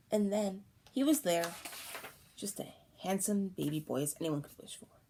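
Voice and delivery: usual speaking voice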